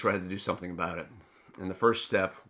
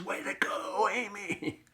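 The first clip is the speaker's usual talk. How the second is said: high mock yell at a graduation; retold yell; 12 semitones higher, 3 dB quieter, slower than usual. Father cheering his daughter at graduation, high mock yell. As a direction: holler it like a proud dad, lightly. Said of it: "I stood up and yelled at the top of my lungs".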